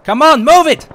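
loud, high hurry-up yell; shouted order. Real, not acted. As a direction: bark at them to move. Hurrying squad into building.

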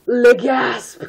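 high, loud hammed-up shock; theatrical mock horror; mock gasp. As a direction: ham up a horrified gasp.

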